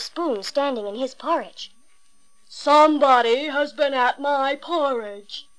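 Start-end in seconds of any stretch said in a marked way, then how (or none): 2.5-5.5 slow, big, rough Father Bear growl; great rough gruff voice. A narrator's reading. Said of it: "in his great rough, gruff voice".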